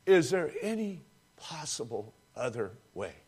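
soft, low, pleading prayer; hushed plea. Acted. Preacher voicing Jesus in the garden, in a soft hushed plea. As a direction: pray it quietly, almost broken. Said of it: "And then we hear".

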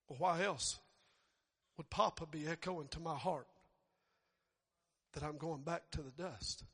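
ordinary talk, nothing marked

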